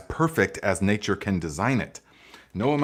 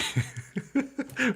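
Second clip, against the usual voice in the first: high, breathless giggle that breaks out; helpless laughter at a funny comment; real burst of laughter; 14 semitones higher, 7 dB quieter than usual. Real, not acted. Cracks up at a comment.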